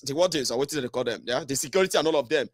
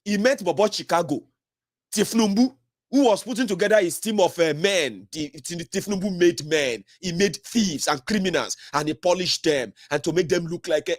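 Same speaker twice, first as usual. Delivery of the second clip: ordinary talk, nothing marked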